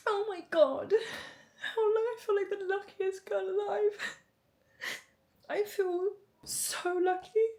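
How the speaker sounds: fighting back tears of joy; crying with gratitude; tearful. Real, not acted. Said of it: "I feel like I'm getting emotional".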